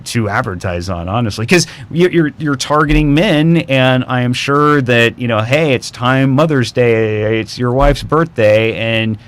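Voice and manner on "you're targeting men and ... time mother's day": normal talking voice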